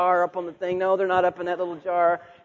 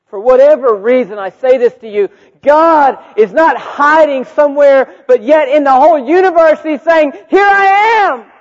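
preaching at full force; 9 semitones higher, 12 dB louder than usual. Acted. Preacher telling the hurting that God is findable, full force. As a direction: proclaim it, then cry out the last words.

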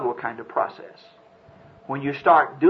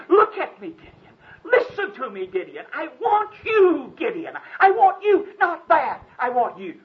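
high, loud pleading angel voice; 11 semitones higher, 3 dB louder than usual. Acted. The angel pleading beside Gideon, high and loud. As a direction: plead with him to look at you. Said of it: "the angel of the Lord saying".

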